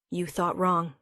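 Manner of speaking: usual speaking voice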